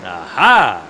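a loud, low gotcha; triumphant aha. Acted. Visitor catching the guard in his own words, loud triumphant aha.

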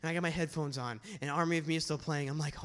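usual speaking voice

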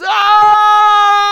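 very long, high shriek fading out; imitated drawn-out scream. Storyteller imitating the noise's last cry, long piercing scream. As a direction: scream full out until the breath runs out. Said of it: "we're more intense and more specific, and it goes".

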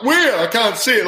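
high, loud startled cry of alarm; suddenly high and loud, caught off guard; retold panic. Teammates point out missing shields, high panicked cry. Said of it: "and I'm like".

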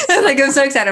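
laughing echo. Real, not acted.

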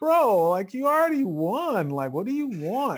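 high pleading whine, loud; exasperated whine. Retold or imitated. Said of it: "And I'd be like".

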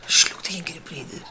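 a tired whispered echo; whispered repeat. Shown as a demonstration. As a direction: whisper it back, drained. Repeats line, second time whispered. (שטיל געשעפטשעט)

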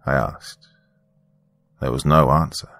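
ordinary delivery, nothing marked

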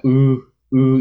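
voiced ooh into call. Shown as a demonstration. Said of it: "you kinda can hear a little bit of voice inflection".

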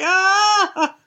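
short high mock cry of a child; mock wail. Kid cries after explosion.

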